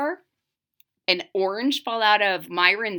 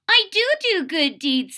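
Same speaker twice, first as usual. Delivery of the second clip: put-on high, louder child voice, protesting; little witch voice; 8 semitones higher, 4 dB louder than usual. Maggie the little witch protests to her family, high and indignant. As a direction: insist like an indignant little girl. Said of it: "said Maggie".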